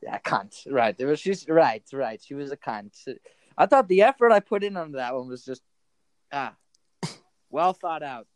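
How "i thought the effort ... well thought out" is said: worked-up comic grievance; indignant. Real, not acted. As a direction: vent about your wasted effort. Indignant at getting no reply.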